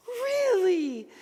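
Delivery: high, loud disbelief; surprised. Retold or imitated. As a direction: gasp it out amazed. Amazed at coincidence.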